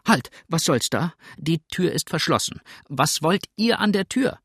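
high, loud challenge through the door; alarmed, scolding landlady; indignant widow. Acted. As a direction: stop the intruder sharply.

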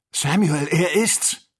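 laut und hoch rufen; aufgeregter Ausruf. A narrator's reading. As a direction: ruf das Erkennen voller Erregung heraus. Kennedy recognises his friend. (aufgeregt rufend)

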